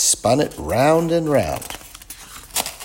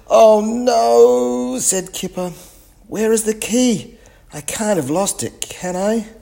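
worried; 14 semitones higher, 6 dB louder, slower than usual. Acted. Kipper realises key is lost.

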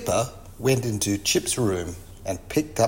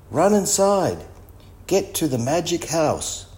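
high-pitched, urgent storybook command; urgent yell; 7 semitones higher than usual. A narrator's reading. Biff hurries the children inside, urgent mild yell. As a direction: hurry everyone along in a raised pitch. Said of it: "she yelled".

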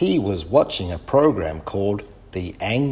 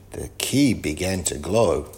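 usual speaking voice

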